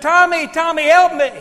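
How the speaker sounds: high, loud mock scream of a drowning boy; panicked cry for help. Drowning second grader calling his friend Tommy, high panicked scream. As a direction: cry out for your friend like you're going under. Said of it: "And I screamed".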